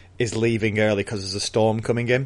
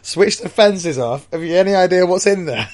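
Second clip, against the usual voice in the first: incredulous; 9 semitones higher, 6 dB louder than usual. Acted. Foreman answering worker about the fences, loudly incredulous. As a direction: throw the suggestion back, appalled. Foreman echoes suggestion in disbelief.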